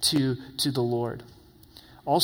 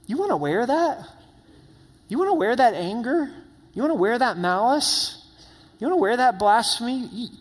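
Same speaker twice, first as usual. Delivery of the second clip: higher voice of a gentle, probing challenge; Holy Spirit's voice; 6 semitones higher than usual. Acted. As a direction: ask it like a loving but pointed nudge from the Spirit. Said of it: "the voice of the Holy Spirit saying".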